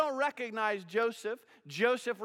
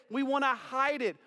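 ordinary delivery

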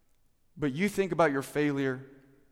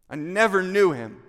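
louder, higher, forceful disowning; emphatic denial; 6 semitones higher, 10 dB louder than usual. Acted. Peter disowning Jesus by the charcoal fire, emphatic denial. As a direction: throw the denial out hard, voice raised.

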